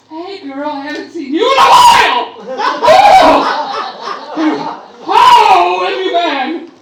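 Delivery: high, loud gushing greeting; gushing acquaintance. Retold or imitated. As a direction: squeal hello like an old friend. Acquaintances greeting her loudly.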